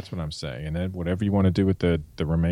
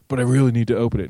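fast, pushy begging, a bit louder; desperate pleading; 5 semitones higher, 6 dB louder, faster than usual. Pretend. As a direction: beg quickly and urgently to open it. Pleading with the locked safe.

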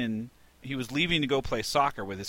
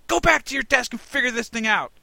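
much higher, louder, kicking him out; angry shout; 12 semitones higher, 8 dB louder than usual. Retold or imitated. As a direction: bark the order like you're throwing him out. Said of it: "I'm yelling at him".

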